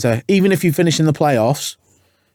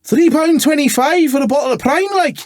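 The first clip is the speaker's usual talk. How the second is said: a furious Scot balking at the price; Scottish accent, shocked and indignant; angry Scotsman; 13 semitones higher than usual. Acted. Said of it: "an angry Scotsman".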